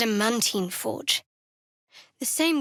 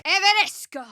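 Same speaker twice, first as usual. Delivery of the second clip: loud, high spell cry; shouted incantation; 9 semitones higher, 5 dB louder than usual. Acted. Casting a spell in battle, shouted incantation. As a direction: hurl the spell word.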